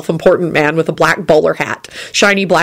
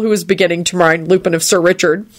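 ordinary delivery

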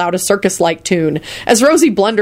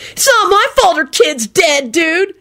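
high, slow whiny protest; high-pitched aggrieved drawl; angry howl; 11 semitones higher, slower than usual. A narrator's reading. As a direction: protest like a wronged dude kid. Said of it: "Kenny howled angrily".

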